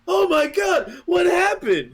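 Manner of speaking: high, loud Oh my God what happened; mock shocked shout. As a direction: cry out like a panicked cop. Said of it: "being like".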